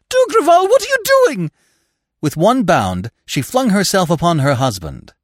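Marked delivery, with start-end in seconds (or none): 0.1-1.5 very high, loud terrified cry; horrified. A narrator's reading. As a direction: scream in horror at him. Wife sees husband with revolver.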